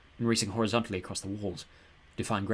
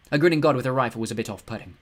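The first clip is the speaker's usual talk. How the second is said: plain reading voice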